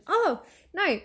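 ordinary talk

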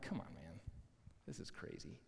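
very low, barely voiced aside; hushed disbelief. Onlooker watching a one-sided fight, hushed disbelief.

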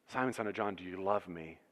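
quiet, tender question. Acted. Jesus repeats the question.

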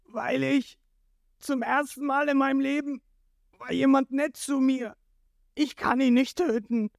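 high, pleading whine; tearful wailing. A narrator's reading. Jin explaining his mercy, high tearful plea. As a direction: wail it like you're in tears. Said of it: "Er nimmt Sanji heulend".

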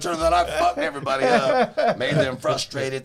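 laughing. Real, not acted. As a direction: laugh at your own mess. Laughing at own failure.

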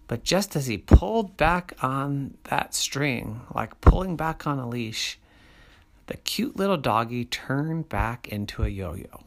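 usual speaking voice

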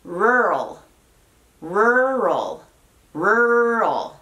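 "Rural" stretched out, Rs gliding into the L; elongated "Rural", one and a half syllables; slowed demonstration. Shown as a demonstration. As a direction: draw out "Rural" as RURRR-ul for learners. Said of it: "Pero a ver, escuchen".

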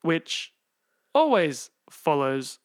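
slow, loud, spaced-out robotic announcement. Acted. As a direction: drag each word out like a robot. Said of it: "I don't know why I'm talking like a robot".

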